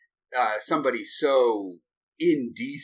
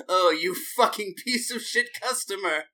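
mock snooty waiter; 5 semitones higher, 3 dB quieter than usual. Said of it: "you're like".

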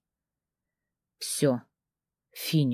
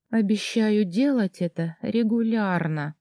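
higher, breathy moan; 4 semitones higher than usual. Acted. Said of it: "простонала я".

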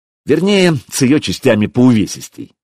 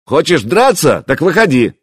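raised, higher dare; shouted challenge; 6 semitones higher, 3 dB louder than usual. A narrator's reading. Prince daring the hero to fight, shouting. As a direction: dare him to come out.

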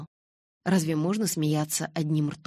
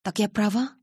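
higher, mildly anxious question; frightened; 5 semitones higher, 4 dB louder than usual. A narrator's reading. Girl asks Edward for confirmation, mildly frightened. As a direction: ask with a touch of fear. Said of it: "испуганно спросила я".

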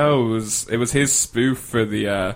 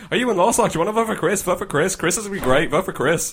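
higher-pitched mock canvasser patter; mimicking canvassers chasing students to vote; pushy canvasser; 6 semitones higher, faster than usual. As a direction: pester like an eager campaigner. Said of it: "people going".

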